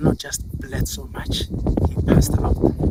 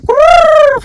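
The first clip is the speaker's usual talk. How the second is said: loud, high trilled elephant noise; elephant roar; 17 dB louder than usual. Acted. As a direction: roll out a big threatening animal trill. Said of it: "shook his head a little bit, like".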